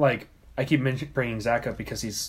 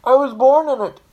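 a high, much louder mock Bane line; hammed-up movie villain quote; Bane impression; 12 semitones higher, 11 dB louder than usual. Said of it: "Do your, do your best Bane yell".

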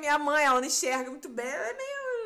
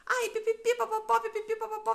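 high babbling nonsense syllables for endless talking; mock chatter; 8 semitones higher than usual. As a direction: chatter pipipi papapó like a nonstop talker.

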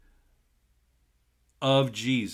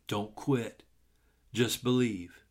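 normal talking voice

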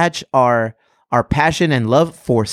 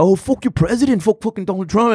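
high-pitched imitation of indignant strangers; mock outburst; 8 semitones higher than usual. Acts out strangers' outburst at Trump.

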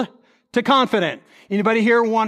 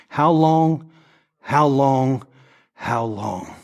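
fading lament; 5 semitones lower, much slower than usual. Pretend. Preacher voicing an addict's cry, fading to despair. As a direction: let it die away in weariness.

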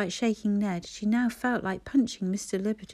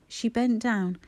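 usual speaking voice

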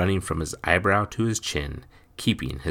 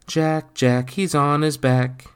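singsong teasing, higher; children's taunt; 9 semitones higher, 5 dB louder, slower than usual. A narrator's reading. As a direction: chant it like mocking kids. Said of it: "they called out".

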